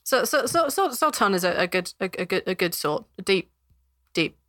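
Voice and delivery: hesitant, well-meaning guard; stammering guard. Acted. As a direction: stumble through a kindly defence.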